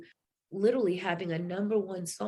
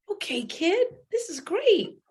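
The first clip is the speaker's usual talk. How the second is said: much higher and louder, bursting with joy; thrilled; 11 semitones higher, 4 dB louder, slower than usual. Retold or imitated. Steve cheering Laura's offer to play Mimi, thrilled and much higher. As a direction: celebrate the big news. Steve reacts to her news.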